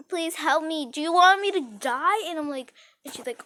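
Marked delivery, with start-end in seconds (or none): 0.1-2.3 higher, louder mock plea; acted pleading. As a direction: beg your friend dramatically. Acts out begging friend for help.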